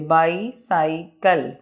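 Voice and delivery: Bicycle as bi, cy, cle with short gaps; slow, three-beat bicycle; short pause after each syllable of bicycle; broken into syllables. Shown as a demonstration. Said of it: "word को रुक रुक के बयान करना".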